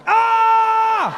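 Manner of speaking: very high, loud yelp of fright; high mock shriek of surprise; startled scream. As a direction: jump out of your skin. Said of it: "And I was like".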